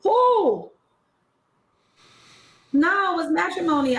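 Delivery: high incredulous who; surprised. Real, not acted. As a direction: blurt it in disbelief. Surprised denial.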